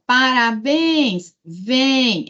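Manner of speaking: paraBÉÉNS, VEEM, stressed syllables held; calling the words. Shown as a demonstration. Teacher testing for oxytones, calling each word. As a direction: call out parabéns and vem, stretching BÉNS and VEM. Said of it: "chama a palavra".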